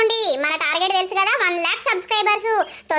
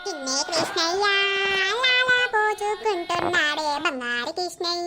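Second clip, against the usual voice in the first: put-on slow sing-song croon to a baby; cooing baby talk; slower than usual. Grandmother bathes her darling little Krishna, crooning slowly. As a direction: dote on the little one, stretching the words.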